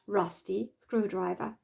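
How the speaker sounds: ordinary delivery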